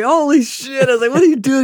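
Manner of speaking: high, loud reunion yelp; delighted surprise. Retold or imitated. Drunk man runs into his buddy, loud surprise. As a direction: exclaim it high, as if spotting an old friend.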